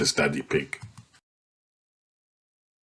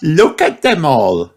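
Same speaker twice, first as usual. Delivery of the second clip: Peppa's shout; 7 semitones higher than usual. A narrator's reading. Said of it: "she shouts".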